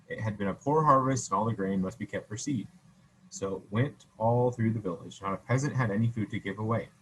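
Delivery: ordinary delivery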